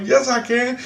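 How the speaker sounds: high, defiant retort; retold cheeky contradiction; defiant comeback. Talking back in acted quarrel.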